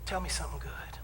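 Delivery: soft unvoiced coaxing; whispered invitation. Minister whispering to a complaining congregant. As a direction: whisper it like a gentle secret.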